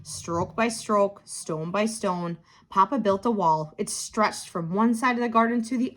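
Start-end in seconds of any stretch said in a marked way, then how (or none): none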